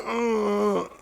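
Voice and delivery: straining grunt. Retold or imitated. Another straining try.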